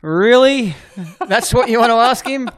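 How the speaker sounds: high, loud, amused disbelief; mock incredulity. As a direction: act out being baffled by a silly question. Mimics own disbelief at reporter's question.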